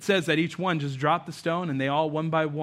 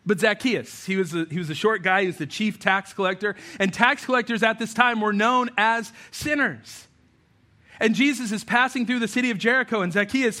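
usual speaking voice

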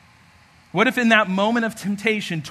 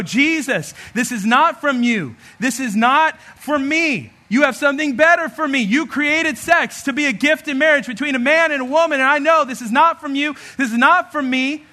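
fervent prayer; 6 semitones higher, 4 dB louder than usual. Shown as a demonstration. Sample prayer, talking to Jesus.

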